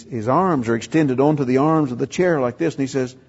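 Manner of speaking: usual speaking voice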